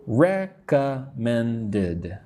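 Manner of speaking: "Recommended" slowly as rec-com-men-ded; four separate syllables. Shown as a demonstration. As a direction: spell out each syllable of "Recommended", drawn out. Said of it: "So four syllables".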